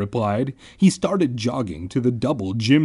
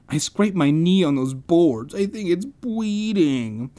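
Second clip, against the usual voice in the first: put-on babyish whine rising higher and slowing; whining; 5 semitones higher than usual. A narrator's reading. Boy complaining about scraped knee, whining. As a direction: whine it, dragging out the end.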